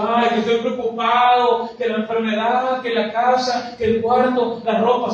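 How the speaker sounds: hammed-up anxious complaining; mock worry. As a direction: pile up troubles like an exaggerated worrier. Acts out a worrier listing troubles.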